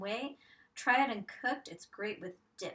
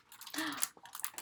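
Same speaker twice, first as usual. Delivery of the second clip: gasp; 5 semitones higher, 5 dB quieter than usual. Acted. Gasp before revealing answer.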